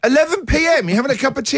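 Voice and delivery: high, incredulous question; can't believe the late hour; shocked disbelief at the time; surprised. Real, not acted. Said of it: "I want a bit of sleep".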